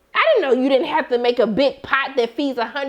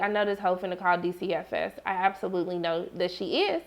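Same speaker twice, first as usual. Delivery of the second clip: lower, much softer knowing aside; suspicious aside; 6 semitones lower, 9 dB quieter than usual. Retold or imitated. Viewer muttering suspicion about the teacher, low and soft. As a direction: mutter it under your breath, sure of it. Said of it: "And I was like".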